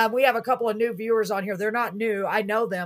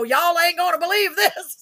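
put-on Waco Texan accent; 9 semitones higher, 4 dB louder than usual. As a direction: let the Waco Texas accent slip out. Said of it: "my Waco slipped out".

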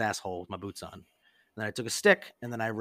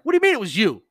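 loud, high indignant disbelief; outraged; 10 semitones higher, 10 dB louder, faster than usual. Retold or imitated. Duped friend confronts the prankster, acted outrage. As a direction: imitate a friend exploding at a confession.

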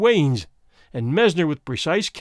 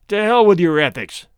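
loud, furious burst; husband bursting out at the blackmailer in anger; angry outburst; 2 semitones higher, 6 dB louder than usual. Acted. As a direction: blurt it out in rage. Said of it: "the other burst out".

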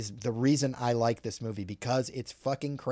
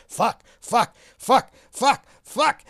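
rising panicked yells of fuck, getting higher and louder; repeated cursing; 10 semitones higher, 9 dB louder, slower than usual. Retold or imitated. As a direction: spit out each fuck, climbing into a pained shriek. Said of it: "he just keeps going".

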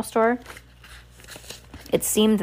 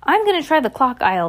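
higher, raised announcement; crying out; 6 semitones higher, 4 dB louder than usual. A narrator's reading. Shopkeeper announces the search to the partner, crying out. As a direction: call it out across the store. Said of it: "the shopkeeper cried".

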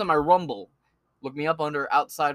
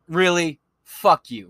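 angry outburst; 8 dB louder, slower than usual. Real, not acted. Shouting insult at Yahoo.

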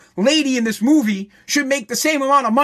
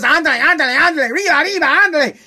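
mock Speedy Gonzales; 4 semitones higher, 3 dB louder than usual.

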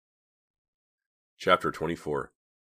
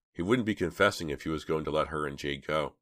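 ordinary delivery, nothing marked

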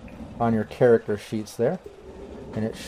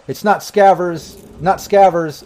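calling back; 5 semitones higher, 4 dB louder, slower than usual. Acted. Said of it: "calls back".

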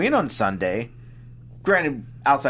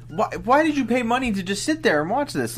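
higher-pitched incredulous appeal; mock exasperation; 9 semitones higher than usual. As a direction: plead with an imagined passive crowd, mildly exasperated. Addressing a passive crowd, exasperated.

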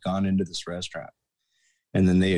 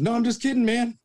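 high-pitched, hurried-sounding mock retreat; nervous backpedalling; 3 dB louder than usual. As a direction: squeak it out to placate him. Backing down before angry Barkley.

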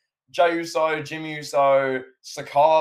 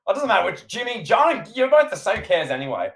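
high-pitched dismissive retort; Roman Reigns; 7 semitones higher, 4 dB louder than usual. Retold or imitated. A wrestling fan mimics the champion backstage, dismissive and high. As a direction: put on an irritated voice, pitched up. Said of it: "where Roman Reigns is backstage".

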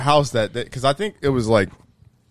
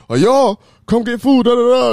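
loud, raised-pitch holler through the house; dad calling out; 9 semitones higher, 7 dB louder than usual. Retold or imitated. Dad calling family to eat, loud casual holler. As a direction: imitate a father summoning the kids. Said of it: "'cause he usually goes".

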